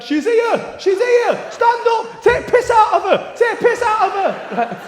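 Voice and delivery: very high, full-volume mock hen-party shouting; rowdy group yelling. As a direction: yell it like a baying crowd. Hen party yells about bride.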